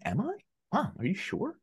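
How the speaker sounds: high-pitched mock-innocent questioning; feigned surprise. Son pretending to mom he's not on it, feigned surprise. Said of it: "And I've been like".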